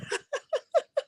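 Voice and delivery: soft high squeal of laughter; quiet high giggle; high wheezing laugh. Real, not acted.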